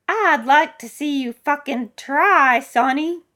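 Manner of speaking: high, loud, slow dare; drawn-out elderly challenge; defiant old woman. Acted. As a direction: dare them as a feisty old lady. Old woman dares the rescuer.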